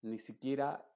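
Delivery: ordinary talk, nothing marked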